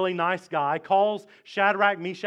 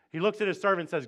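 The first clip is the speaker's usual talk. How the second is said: usual speaking voice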